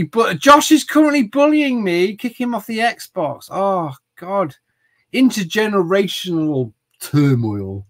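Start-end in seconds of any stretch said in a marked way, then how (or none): none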